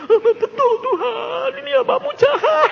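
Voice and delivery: comic tearful wailing at high pitch; imitated crying. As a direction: sob out a confession, hammed-up. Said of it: "Nangisnya tiga kali lipat".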